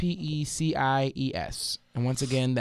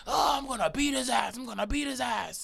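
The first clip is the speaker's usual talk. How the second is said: hollering; 9 semitones higher, 4 dB quieter than usual. Retold or imitated. Imitating the man as he's led away, hollering. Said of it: "he kept hollering".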